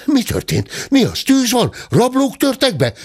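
high, agitated questioning; acted alarm, leaping high; jumbled alarmed questions. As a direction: fire off questions like several startled staff at once. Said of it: "kérdezte össze-vissza".

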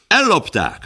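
high, cutting retort; sharp retort. Acted. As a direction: fling the word straight back. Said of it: "vágott vissza Wilson".

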